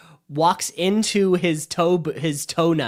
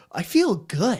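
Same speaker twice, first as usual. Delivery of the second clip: lightly voiced euphoric Jack, higher pitch; blissed-out Jack; 5 semitones higher, slower than usual. Recapping the elevator drink scene, mock blissed-out. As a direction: play it high and blissfully confident. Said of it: "like they're coming up on some acid".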